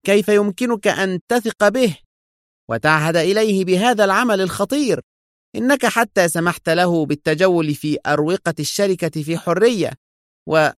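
high-pitched heated protest; indignant tirade, cut off; exasperated. A narrator's reading. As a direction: remonstrate angrily, pitch held high. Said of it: "وهو يستطرد في حنق".

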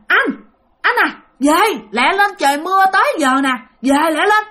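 high, insistent call; imitated wife calling her husband. Mother calls unresponsive husband again.

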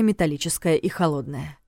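usual speaking voice

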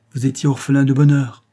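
plus aigu et plus fort, naïf comme une enfant; naïveté enfantine. A narrator's reading. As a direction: une touche de candeur enfantine. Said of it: "avec sa naïveté enfantine". (Marguerite dit à André sa peine avec naïveté enfantine)

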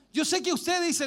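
usual speaking voice

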